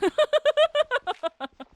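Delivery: high cackle of laughter; loud high laugh. Real, not acted. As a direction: burst of shrill laughter.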